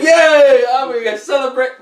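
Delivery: high, loud mock-excited cheer. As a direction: imitate an overexcited celebration. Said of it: "It was just like".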